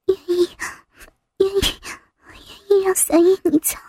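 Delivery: faint, hesitant whispering; halting, then a quick hushed rush; small voice. Acted. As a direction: say it timidly under the breath. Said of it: "小声说".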